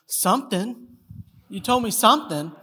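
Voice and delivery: high imitated toddler voice; two-year-old's classic reply. Son looks up at dad with his classic answer, in toddler voice. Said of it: "in his classic phrase for this situation".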